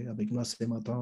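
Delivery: ordinary talk, nothing marked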